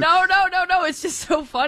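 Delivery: high, giggly protest through laughter; real amusement breaking into the words; laughing. Real, not acted. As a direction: laugh while insisting, pitched well up. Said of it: "What are you laughing at?".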